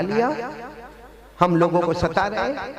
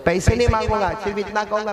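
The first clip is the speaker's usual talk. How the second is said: ordinary talk, nothing marked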